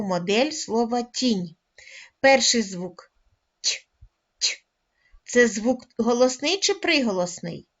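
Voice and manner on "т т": lone soft Т, palatalized as ть, said twice. Shown as a demonstration. Teacher isolating the first sound of тінь, soft Т. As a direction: say Т as ть, no vowel, twice. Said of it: "Це м'який приголосний".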